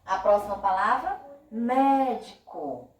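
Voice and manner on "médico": "médico" slow, with a strong MÉ; stressed first syllable. Shown as a demonstration. Teacher showing the most audible syllable, slow "médico" stressing MÉ. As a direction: stretch and lean on MÉ in "médico". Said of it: "mais ouvido, é o mé".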